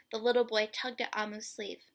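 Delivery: ordinary delivery, nothing marked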